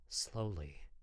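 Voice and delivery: plain reading voice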